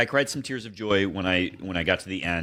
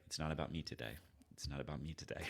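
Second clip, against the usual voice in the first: very soft, low, barely-there voice; quiet self-effacement; 4 semitones lower, 20 dB quieter, faster than usual. Retold or imitated. Player sitting quietly in the locker room, hushed and low. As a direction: say it quietly, keeping the attention off yourself. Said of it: "sit there quietly and be like".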